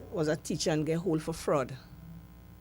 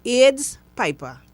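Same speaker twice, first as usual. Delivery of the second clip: loud, slow jeering nickname; mocking label; 7 semitones higher, 12 dB louder, much slower than usual. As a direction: sneer it out slowly and loudly.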